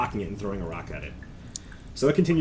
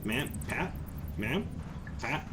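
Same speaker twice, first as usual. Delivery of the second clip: short questioning words with a rising lilt; puzzled creature echo; 8 dB quieter, slower than usual. Acted. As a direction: repeat each word curiously, like a young creature. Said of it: "they repeat a couple of words".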